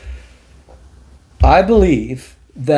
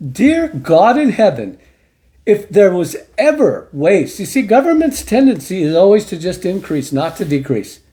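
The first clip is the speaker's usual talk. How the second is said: ordinary talk, nothing marked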